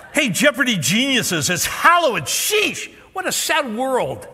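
high, loud sneering scold; mock their ignorance loudly; sarcastic outraged fan. Fan mocking Jeopardy contestants, high loud sarcasm. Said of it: "one response".

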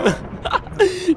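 high laugh. Real, not acted. After dying in game.

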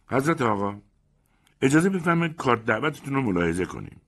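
high, overly polite and pointed; best man's mock politeness. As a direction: needle him with fake courtesy. Best man confronts guest.

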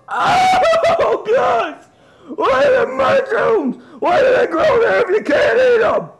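high, wailing melodramatic meltdown; over-the-top horrified wailing; hysterical panic. Acted. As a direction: shriek it like a disaster has struck. Hysterical over mushrooms in house.

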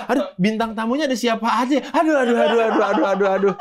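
mock flustered excitement. Host giddy over planning a live show. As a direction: flap about giddily at the idea of a show.

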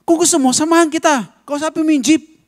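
high, loud mock-angry taunt; angry retort. Angry driver daring the pastor to confront the jeep, high and loud. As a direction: throw the challenge back, heated. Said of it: "galit na galit pa siya".

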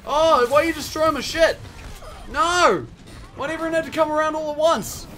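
loud, high cry of protest; exasperated outburst. Real, not acted. Turrets destroyed, swarmed in game.

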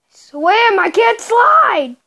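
loud, high, stretched yell; yelling at the controls; frustrated shout. Real, not acted. Frustrated in game.

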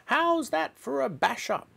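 put-on very high, squawking old-woman holler; grandma shouting. A narrator's reading. Grandma on the roof to George, shouting. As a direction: call down in a piercing granny voice. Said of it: "she shouted".

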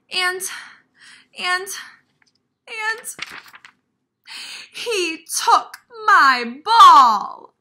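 high, loud, wailing Piggy, slowing down on the bad news; a small pig crying out to her friend about the stolen ball; upset little Piggy. Reads Piggy's lines in voice.

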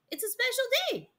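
very high, quick, singsong voice; chirpy nurse. Acted. Nurse explaining the special day, chirpily.

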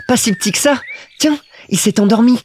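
put-on high little-boy voice; observing Petit Prince. A narrator's reading. Little prince peers at his sheep drawing, high and childlike. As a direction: remark on it like a small child, pitched high.

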